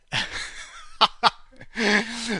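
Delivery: bright burst of laughter; laughing. Real, not acted. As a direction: laugh out loud. Laughing at Sean Connery joke.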